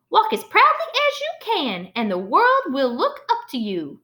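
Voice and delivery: high, lilting Southern grandma's advice; a light try at a Southern grandma accent. Acted. Grandma advising Molly Lou, in a Southern grandma voice. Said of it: "Notice I tried to get in that grandma voice, that, like, Southern grandma".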